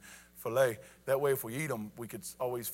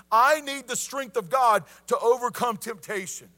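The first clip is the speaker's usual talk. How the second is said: high, loud, impassioned sermon; full-force preaching; 6 semitones higher, 8 dB louder than usual. Real, not acted. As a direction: drive each point home to the congregation.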